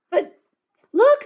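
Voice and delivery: very high, loud eager call; girl pointing her brother to the cat, excited. A narrator's reading. Call out in delight.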